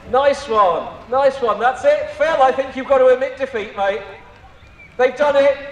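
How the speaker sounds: ordinary delivery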